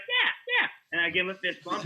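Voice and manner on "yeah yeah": put-on kid's voice. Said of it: "he's usually like".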